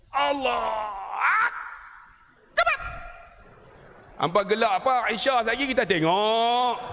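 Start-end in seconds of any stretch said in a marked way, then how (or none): 0.1-2.8 drawn-out shouted Allah, short Akbar; mock takbir shout. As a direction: belt the takbir like an anxious imam. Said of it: "orang Melayu kita bagi banyak takbir".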